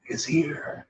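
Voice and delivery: whispered, reverent; awed whisper. Real, not acted. Preacher hushing the room about God, whispered. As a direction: breathe it out in hushed awe. Hushed awe at God's presence.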